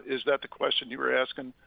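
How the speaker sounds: ordinary delivery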